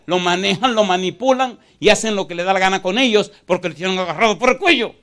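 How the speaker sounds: high, loud and driving; fervent shouted preaching; preaching at full force. Real, not acted. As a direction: hammer it home to the church. Preacher building to climax.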